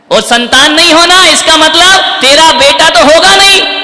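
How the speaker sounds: preaching at full force. Real, not acted. Preacher pressing Kaikeyi's scheme on the audience, full force. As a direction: drive the point home to the crowd.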